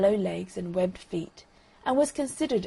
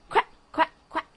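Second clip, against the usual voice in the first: higher quacks fading; duck quack; 8 semitones higher, 4 dB louder than usual. Acted. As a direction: quack as you paddle off. Duck swims away quacking.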